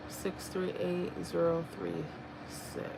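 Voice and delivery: slow, very soft number reading; muttering to self. Real, not acted. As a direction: murmur the digits as you check them. Reads numbers to self while comparing.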